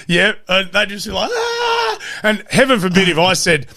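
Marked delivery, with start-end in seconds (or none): 1.3-2.0 put-on kids' cheer. Kids cheering for ice cream.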